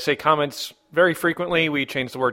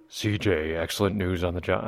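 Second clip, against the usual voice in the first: much lower, softer deep-voiced reading; Computer King's voice; 9 semitones lower, 5 dB quieter than usual. Host imitating a listener's deep voice, much lower and softer. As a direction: drop way down into a deep bass voice. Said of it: "Computer King has the deepest voice out there".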